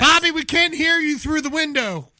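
calling out. Real, not acted. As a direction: call through the glass. Calling to Bobby outside.

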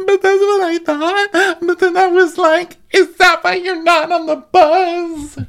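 squeaky accusing imitation; high mocking voice.

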